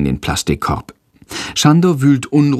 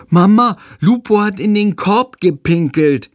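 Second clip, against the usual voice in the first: put-on high-pitched little boy voice; child calling mum; 10 semitones higher than usual. A narrator's reading. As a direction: pipe up to tattle to your mother. Child calls out to his mother. (hohe Kinderstimme, petzend)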